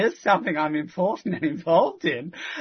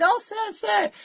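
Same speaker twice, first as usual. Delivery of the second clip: high-pitched imitation of someone hailing a man; retold calling out. Booklet givers hail a passer-by, imitated high call. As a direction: call "sir" high, as a quoted stranger.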